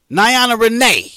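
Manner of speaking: high, loud show-host introduction; calling out. Acted. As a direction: announce the co-host's name with a big flourish. Host calls in co-host by name.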